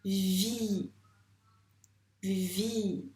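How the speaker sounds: "Vie" with a held v and long vowel; slow, careful dictation of "Vie"; dictated syllable. Shown as a demonstration. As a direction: stretch out both sounds of "Vie".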